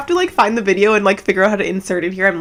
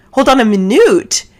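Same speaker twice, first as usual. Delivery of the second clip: louder, higher retold double take; punched-up, higher-pitched mock shock; incredulous interruption; 4 semitones higher, 7 dB louder than usual. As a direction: halt everything with a loud, disbelieving repeat.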